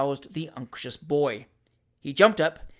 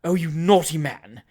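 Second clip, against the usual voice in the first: slow, louder teasing reproach; coy scolding; 6 dB louder than usual. A narrator's reading. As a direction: play the flattered spinster. Aunt coyly scolds.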